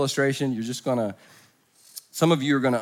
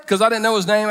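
ordinary delivery

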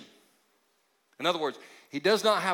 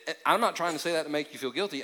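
usual speaking voice

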